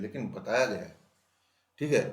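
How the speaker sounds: ordinary talk, nothing marked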